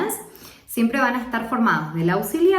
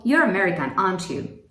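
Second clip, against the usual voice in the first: "aren't you" falls low, only checking; falling tag intonation; 4 semitones lower than usual. Shown as a demonstration. Teacher checking that someone is American, falling tune on "aren't you". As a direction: drop the pitch on "aren't you", nearly certain. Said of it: "Estoy casi segura que es americano".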